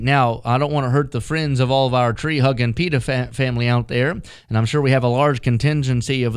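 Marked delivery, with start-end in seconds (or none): none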